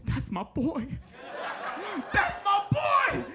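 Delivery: bursting with pride at his son; proud dad. Retold or imitated. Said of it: "He said".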